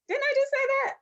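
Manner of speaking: very high, squeaky incredulous question; mock disbelief. Woman reacting to men repeating her, high mock disbelief. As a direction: play up baffled indignation in a high pitch. Acts reaction to being talked over.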